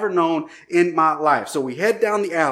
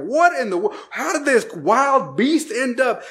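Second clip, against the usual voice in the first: retold angry yelling; 7 semitones higher than usual. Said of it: "they start yelling".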